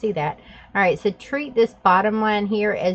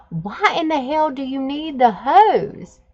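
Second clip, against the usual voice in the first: higher, strained, incredulous; retold exasperated demand; 7 semitones higher, 4 dB louder than usual. Mother confronting teens over the hose, exasperated. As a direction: press them hard for an answer.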